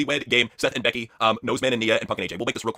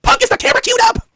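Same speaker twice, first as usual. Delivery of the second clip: high, bright put-on voice; mock Stephanie; 4 dB louder than usual. Stephanie checks with Punk, high put-on voice. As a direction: call across the room in a high voice. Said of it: "Batman said impression".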